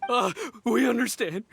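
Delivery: normal talking voice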